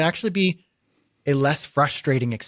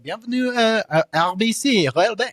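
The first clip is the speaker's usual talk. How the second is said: higher mock French-Canadian phone voice; Quebec bank line answering in French, higher mock French; mock French greeting; 7 semitones higher than usual. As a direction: put on a French accent for the bank menu.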